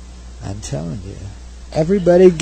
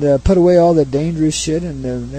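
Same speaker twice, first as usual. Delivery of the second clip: ordinary talk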